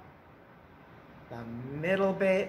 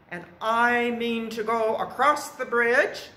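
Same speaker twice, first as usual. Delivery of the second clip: higher, loud goat voice; middle billy goat; 6 semitones higher, 6 dB louder than usual. A narrator's reading. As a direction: announce yourself as the middle goat, pitched up.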